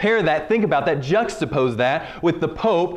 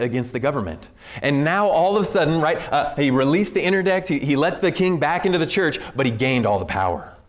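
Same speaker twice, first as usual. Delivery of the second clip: ordinary talk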